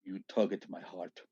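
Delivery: put-on soft Russian-accented woman's voice. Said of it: "in her Russian accent".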